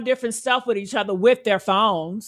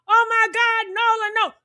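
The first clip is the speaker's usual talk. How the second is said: higher, mock horrified wail; mock panic cry; 8 semitones higher than usual. Imagining the bystanders on the call, a higher mock wail. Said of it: "everybody in the back talking about".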